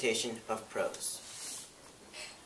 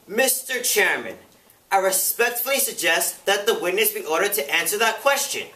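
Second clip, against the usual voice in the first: formal demand, raised and emphatic; insistent committee questioner; 5 semitones higher, 5 dB louder than usual. Acted. Committee member appealing to the chairman, insistent. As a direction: press the chair firmly for a ruling.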